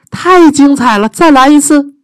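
high, loud excited call; shouting. Acted. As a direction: wave him on again. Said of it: "伊丽莎白又叫着".

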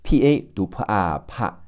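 ordinary talk, nothing marked

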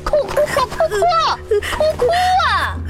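hysterical drill-sergeant screaming; frantic screaming. Acted. Examiner screams at candidate to cry.

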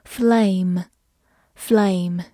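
slow, careful vocabulary reading; teacher modelling the word slowly; slow model reading. Shown as a demonstration. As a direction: read the word slowly for learners. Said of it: "vocabulary word read as a model".

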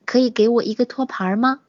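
ordinary delivery

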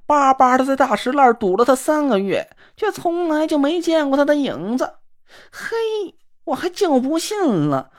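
simpering sissy man. Acted. Na Ruolan fawning after the girl who ignores him, simpering. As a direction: coo at her like a fawning dandy. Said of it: "她嘟囔了几声".

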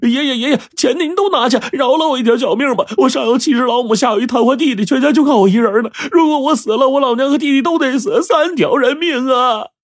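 high, wailing plea for mercy; crying and begging. A narrator's reading. Kneeling Wang Mao begs the robbers, wailing and crying. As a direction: sob out a desperate plea for his life. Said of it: "边磕就边哭".

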